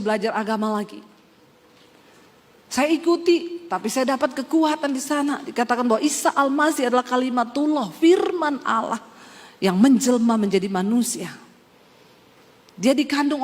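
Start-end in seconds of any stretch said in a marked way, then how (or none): none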